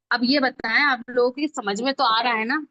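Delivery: usual speaking voice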